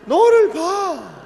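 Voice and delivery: high, loud, slow "너를 봐"; acted tenderly. Shown as a demonstration. Pastor modelling the phrase to say to a neighbour, acted. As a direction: really act it out. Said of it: "연기를 해야 돼요".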